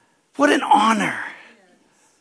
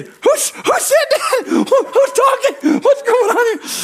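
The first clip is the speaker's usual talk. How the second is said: startled mock shout; 6 dB louder, slower than usual. Imagined startled reaction to sweet God.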